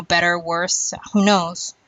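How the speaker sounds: normal talking voice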